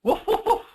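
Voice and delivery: very high yappy woof woof woof; dog barks. Acted. Mom reading the sheepdog page, squeaky high barking. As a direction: bark like a little cartoon dog. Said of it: "Barks the sheepdog".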